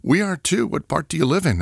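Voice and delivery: eager, high-pitched friendly question; brightly. Acted. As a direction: perk up and sound delighted to meet them. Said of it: "You say brightly".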